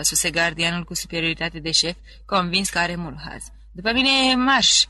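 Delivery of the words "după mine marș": loud, high order to march; head guard commanding the prisoner; barked command. A narrator's reading. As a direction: snap the order like a drill sergeant.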